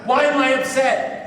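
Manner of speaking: high-pitched mock yell; retold yelling. Demanding an answer from his sponsor, yelling. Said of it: "now I'm yelling at him".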